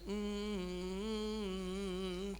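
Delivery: soft, drawn-out hum; absent-minded hum. Retold or imitated. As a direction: hum quietly as if a tune slipped out. Imitates tune humming up from within. (మెల్లగా సాగదీసిన కూనిరాగం)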